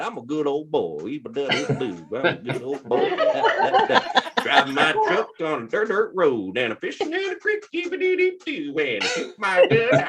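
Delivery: mock twangy country crooner; hammed-up good old boy; imitating a fake cowboy singer; young country singer.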